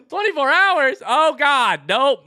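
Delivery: high, loud laughing disbelief; shocked laugh. Real, not acted. Streamer reading a wild request, high laughing shock.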